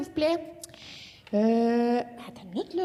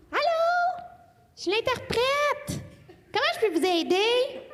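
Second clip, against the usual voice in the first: higher, bright phone-operator voice with a laugh; interpreter voice; 7 semitones higher than usual. Pretend. Playing the relay interpreter answering her call, a cheery operator voice.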